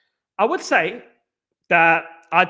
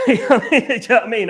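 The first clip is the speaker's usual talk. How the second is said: laughing; 5 semitones higher, 3 dB louder than usual. Real, not acted. Laughs at his own boast.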